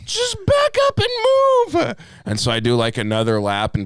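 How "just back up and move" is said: very high, slow squeaky voice; mock falsetto insult. Yelling at parked driver in car.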